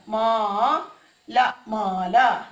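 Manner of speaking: very slow, stretched മാല; teacher slowly modelling the word മാല; drawn out syllable by syllable. Shown as a demonstration. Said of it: "ആഭരണമാണ് മാല".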